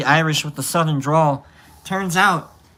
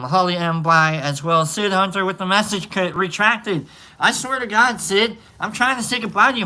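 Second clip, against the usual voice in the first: normal talking voice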